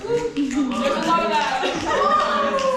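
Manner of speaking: loud, high laugh through the words. Real, not acted. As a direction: draw it out while cracking up. Laughs along with the audience.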